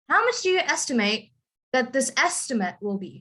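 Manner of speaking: verb "estimate" ending in a rising full "ate", noun "estimate" front-stressed; noun-verb stress contrast. Shown as a demonstration. Teacher showing a student how to say the sentence, noun-verb stress contrast. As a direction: model "estimate" as verb with stressed end, then as noun with stressed start. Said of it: "So you would say this as".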